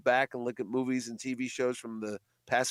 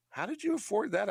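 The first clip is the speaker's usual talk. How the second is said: fans' astonished question, much higher; imitating amazed fans; 9 semitones higher than usual. Fans asking the host about his ads, high astonished voice. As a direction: gasp it out like a stunned fan.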